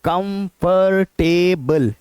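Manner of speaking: कंफर्टेबल drawn out slowly as "kum-fur-tay-bul"; four syllables. Shown as a demonstration. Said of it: "चार बार आवाज निकालते हैं".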